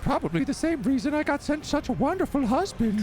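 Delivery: high, sugary wife voice; sweet wife. Acted. Wife sweetly answers her husband in a high voice.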